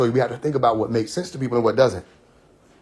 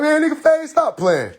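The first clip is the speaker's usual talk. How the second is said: mock outburst; 12 semitones higher, slower than usual. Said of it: "They're like".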